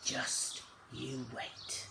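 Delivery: slow, breathless whispered warning; hushed threat. Acted. Father warns the children of Billy's punishment, slow whispered menace.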